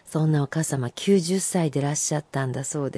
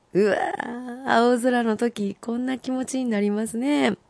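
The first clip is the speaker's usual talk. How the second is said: hushed, higher marvelling; soft awe; 4 semitones higher, 4 dB quieter, slower than usual. Real, not acted. Host marvelling at the sky haiku, soft and hushed. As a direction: breathe out wonder softly.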